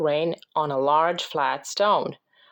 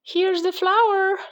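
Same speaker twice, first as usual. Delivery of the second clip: put-on high, drawn-out boyish call; storybook shout, pitched way up; excited shout; 12 semitones higher, 4 dB louder, slower than usual. A narrator's reading. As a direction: call it out high and slow, as Jack. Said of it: "shouted Jack".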